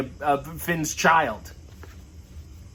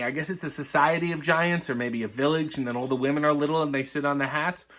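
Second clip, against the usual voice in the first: ordinary delivery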